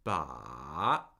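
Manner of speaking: "Ba" dipping very low, then rising; low fall-rise on Ba; third tone. Shown as a demonstration. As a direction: sink deep on "Ba" and swing back up. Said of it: "with a third tone".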